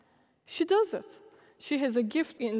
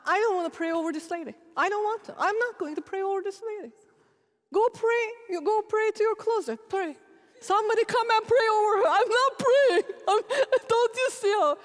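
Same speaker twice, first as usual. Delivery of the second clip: whiny refusal; 9 semitones higher than usual. Pretend. Exhausted preacher protesting to God, higher whiny mock tantrum. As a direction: sulk and refuse like a worn-out kid, hammed up.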